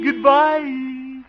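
high, loud lovelorn goodbye; lovestruck farewell. Acted. Smitten man seeing his sweetheart off, giddy farewell.

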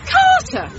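sharp high call of his name; high, commanding call. Acted. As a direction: summon him across the field. Wife calls out to husband.

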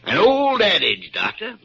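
loud, high and gleeful; triumphant. Acted. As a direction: crow it out. Doctor gloating.

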